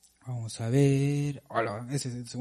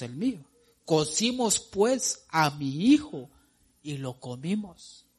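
booming pulpit reading; emphatic scripture reading; 5 semitones higher, 4 dB louder than usual. Acted. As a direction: read it like a preacher building the scene.

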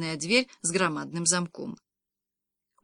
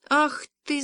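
mock yell; 7 semitones higher than usual. A narrator's reading. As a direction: exclaim in higher-pitched mild outrage. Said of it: "заорал Кирка".

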